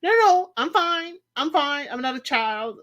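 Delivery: high, insistent mock protest; defensive protest. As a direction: brush off the help, a little wounded. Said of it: "She's like".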